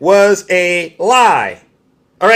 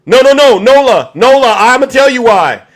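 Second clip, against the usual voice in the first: heated; 5 semitones higher, 6 dB louder than usual. Real, not acted. Heated reply to chat viewer Nola.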